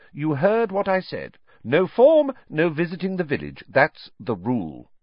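high, firm refusal slowing to a close; strict teacher. A narrator's reading. McGonagall refusing Harry's request, strict and final. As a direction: recite the rule with finality.

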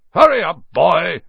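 high, loud bellow; shouting. A narrator's reading. Uncle calls boy from the kitchen, shouting. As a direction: yell an order from another room. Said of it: "shouted Uncle Vernon from the kitchen".

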